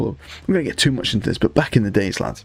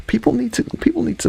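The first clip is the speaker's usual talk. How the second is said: squeaky insistence; 14 semitones higher, faster than usual. Real, not acted. Pitch climbs to squeak.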